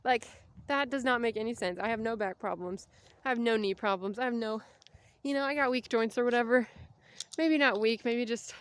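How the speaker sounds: ordinary talk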